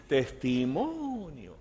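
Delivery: slow, soft, drawn-out disbelief; quietly scornful, long stressed vowel; incredulous echo. Retold or imitated. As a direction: stretch the word in mock astonishment. Pastor's drawn-out incredulous inner echo.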